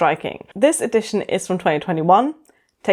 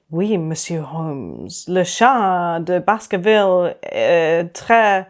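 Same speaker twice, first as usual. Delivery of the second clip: mock French accent; 2 semitones lower, slower than usual. Mock French Sherlock line.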